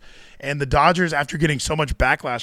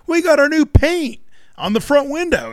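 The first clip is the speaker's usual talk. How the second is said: mock cheery announcement; 12 semitones higher, 4 dB louder than usual. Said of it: "they shared, like".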